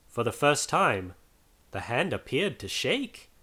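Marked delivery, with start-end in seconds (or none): none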